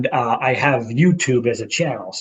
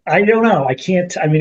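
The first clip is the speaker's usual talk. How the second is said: ordinary talk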